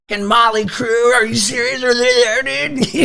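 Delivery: put-on high, loud, excited teen voice; amazed teenager blurting it out; excited kid. Teen asking Doc's brother on the phone, breathless and excited.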